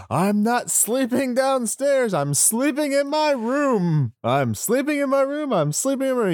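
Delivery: high whiny insistent repeating; demanding voice. Retold or imitated. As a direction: repeat it like a big baby. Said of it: "in a demanding voice".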